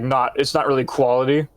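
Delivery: ordinary delivery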